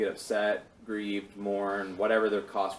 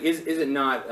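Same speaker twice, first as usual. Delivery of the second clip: higher, pointed rhetorical question; mildly sarcastic; 5 semitones higher, 5 dB louder than usual. Retold or imitated. Teacher voicing God's rhetorical question, mildly sarcastic. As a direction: ask it as if the answer is obvious.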